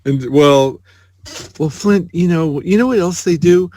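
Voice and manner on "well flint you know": normal talking voice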